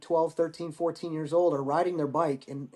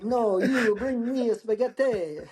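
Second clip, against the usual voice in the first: slow, higher-pitched mock Italian accent; hammed-up Italian English; 6 semitones higher, 3 dB louder, slower than usual. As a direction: lay on a fake Italian accent, laughing. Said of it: "laying on the Italian English".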